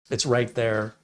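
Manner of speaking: ordinary talk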